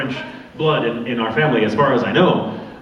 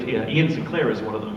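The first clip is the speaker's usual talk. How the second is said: usual speaking voice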